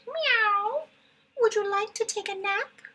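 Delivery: high sweet meowing cat. Acted. The cat inviting the spider to nap, high and sweet. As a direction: purr the offer like a sleepy cat.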